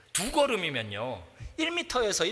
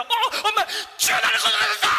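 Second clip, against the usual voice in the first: high, loud, panicked shout half-laughing; desperate cry for help; 4 dB louder, faster than usual. Shown as a demonstration. Preacher showing how Peter really cried out, in panic. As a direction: scream for rescue as if drowning. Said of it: "이렇게 해야 맞아요".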